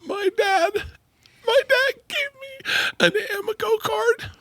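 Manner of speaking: real high-pitched squeaky laughing speech; helpless laughing. Real, not acted. Son starting a story about dad's gas card, helpless laughing. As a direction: barely get the words out through laughter.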